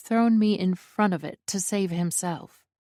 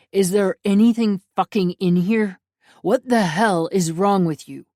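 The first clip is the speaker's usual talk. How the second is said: much louder furious scolding; angry scolding; 8 dB louder than usual. A narrator's reading. Alessio scolding his reckless brother, loud and angry. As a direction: tear into him, exasperated.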